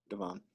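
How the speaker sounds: "Devon" repeated softly as duh-VON; soft "Devon", stressed on the second syllable; correct name pronunciation. Shown as a demonstration. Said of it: "how do I pronounce it".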